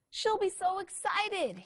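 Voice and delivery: high, excited little scooter; excited buzz. Acted. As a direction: buzz with eagerness. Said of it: "he buzzed".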